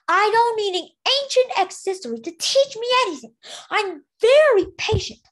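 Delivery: icy queen boasting of her patience; haughty ice queen. Acted. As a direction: sneer it out like a cold, impatient queen. Snowfall's voice, held.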